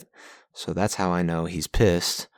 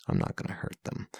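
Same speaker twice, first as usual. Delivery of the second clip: soft, unvoiced take-back; taking back a threat, whispered; whispered retraction; 13 semitones lower, 10 dB quieter, faster than usual. Real, not acted. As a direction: breathe it as a sheepish aside.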